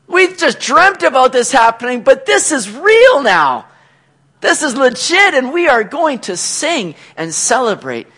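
loud, high-pitched rejoicing; elated, full-voiced exclaiming; jubilant celebration. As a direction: proclaim it like a freed captive. Acting out jubilant returning captives.

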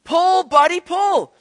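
high, loud yell to the horse; a farmer's shout across the field. Retold or imitated. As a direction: call the horse hard. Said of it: "the farmer yelled".

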